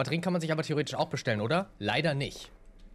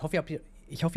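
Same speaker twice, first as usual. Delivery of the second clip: ordinary talk, nothing marked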